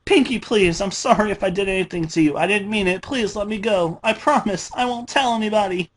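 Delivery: frantic begging to be let go; desperate pleading. A narrator's reading. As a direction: beg for your life, fast and high. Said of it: "Desperately, Dash pleaded".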